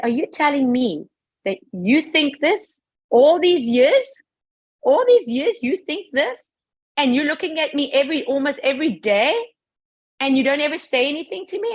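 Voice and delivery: high, incredulous challenge; indignant confrontation. Retold or imitated. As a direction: retell the outraged questioning, pitch climbing. Acted confrontation with her sister.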